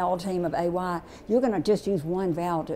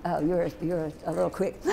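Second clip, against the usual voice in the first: normal talking voice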